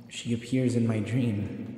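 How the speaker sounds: ordinary delivery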